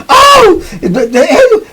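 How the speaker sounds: very high, laughing gasp; shocked guard. Retold or imitated. Guard seeing all the blood, shocked yelp. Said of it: "saw all that blood".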